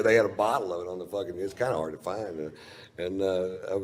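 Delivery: normal talking voice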